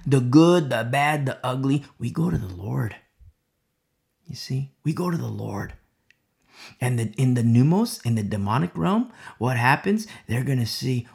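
usual speaking voice

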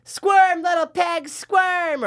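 taunting movie quote. Retold or imitated. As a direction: taunt like a bully. Quoting movie line.

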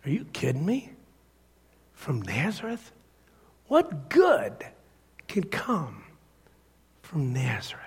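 hushed disbelief, with pauses between phrases; quiet, halting incredulity; incredulous response. Acted. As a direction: play the sceptical friend, softly scoffing. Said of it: "Nathanael's response was this".

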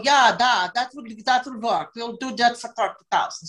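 hammed-up Russian accent; lightly put-on Russian voice. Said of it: "So that's my Russian accent".

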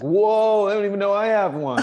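high, loud fake shock; mock surprise. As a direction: play dumb, loudly surprised. Brother's mock surprise quote.

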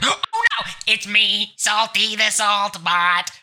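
slow, high goofy robot voice; Salty the Salt Bot. Acted. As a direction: do a silly salt robot character. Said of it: "I accidentally turned him on".

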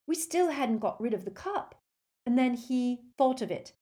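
high, fast, excited rush; breathless excitement. Acted. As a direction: gush out the story breathlessly. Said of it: "said Hermione breathlessly".

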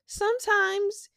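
ordinary talk, nothing marked

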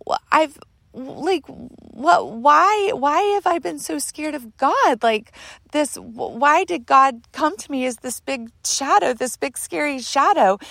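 high, halting, stammered questioning; distressed bewilderment. Retold or imitated. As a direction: plead to understand, pitched well up. Questioning her fear of God.

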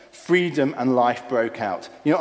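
ordinary talk, nothing marked

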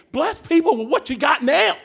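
high, loud preacher's charge; preaching shout. Real, not acted. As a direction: call it out to the congregation. Preacher exhorts loudly.

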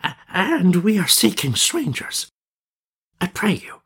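put-on high, trembling old man's voice; old man, high and eager. A narrator's reading. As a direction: quaver eagerly like an aged elder. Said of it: "addressed them in eager, trembling tones".

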